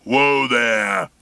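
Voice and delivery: lightly voiced roar from an old man; a mild mock roar at an intruding car; mean roar. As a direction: call out gruffly from the dark. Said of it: "a great mean voice roared out".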